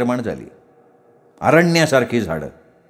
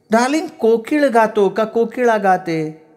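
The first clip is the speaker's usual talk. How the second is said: high, slow woman's voice; inquiring sweetheart; 8 semitones higher, slower than usual. A narrator's reading. Woman quizzing her darling about cuckoos, high voice. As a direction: ask slowly in a high, put-on pitch.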